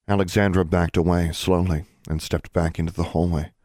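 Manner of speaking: usual speaking voice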